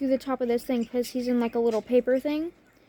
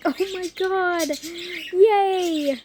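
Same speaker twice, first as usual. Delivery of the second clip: higher, slow, a lightly voiced grandma's mock cheer; grandma's playful higher "yay"; amused grandma; 6 semitones higher, 4 dB louder, much slower than usual. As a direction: cheer along with your grandchild, half laughing. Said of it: "she starts laughing".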